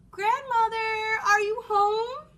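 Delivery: put-on loud, slow calling girl. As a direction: call into the house. Red calls out.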